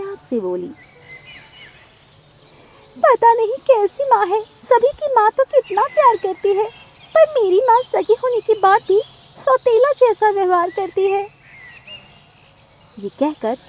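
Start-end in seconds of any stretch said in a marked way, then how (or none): 3.0-11.2 high, loud wailing little bird; tearful lament. Acted. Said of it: "टुनी चिड़िया रोते हुए". (रोते हुए ऊँची आवाज़ में शिकायत)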